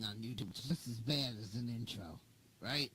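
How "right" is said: ordinary talk, nothing marked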